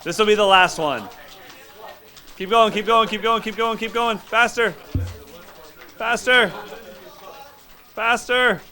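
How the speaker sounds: screaming cheer. Real, not acted. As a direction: scream them on to the finish. Coach screaming encouragement final round.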